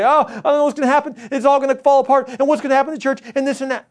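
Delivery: high, loud, fast mock panic; a worried churchgoer spiralling into panic; panicky. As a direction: rattle it off like someone losing it. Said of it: "we could make panic and say".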